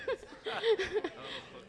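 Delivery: high nervous giggle; embarrassed laugh; stage fright. Real, not acted. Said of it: "I'm a little nervous".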